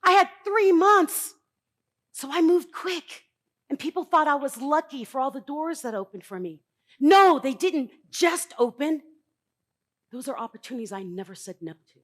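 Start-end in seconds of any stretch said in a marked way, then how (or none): 0.0-3.2 slow, loud and higher; emphatic. Real, not acted. Speaker stressing urgency to audience. 7.0-9.0 slow, loud, deliberate; emphatic denial. Real, not acted. Speaker correcting the audience's idea of luck.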